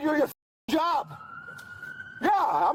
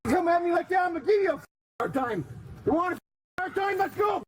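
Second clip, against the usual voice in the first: angry threats; 4 semitones higher than usual. Real, not acted. Man threatening officers.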